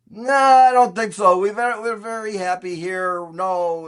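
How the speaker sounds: high, drawn-out mock brush-off; retold refusal. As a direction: play an owner waving off a buyer. Acted Marv turning down the caller.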